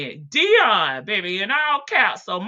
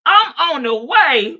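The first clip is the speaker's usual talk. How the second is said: loud, high angry mama; loud mock threat; mock mama yelling; 6 semitones higher, 9 dB louder than usual. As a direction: bark it like a mad mother. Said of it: "Mama say".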